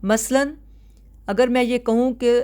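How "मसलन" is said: normal talking voice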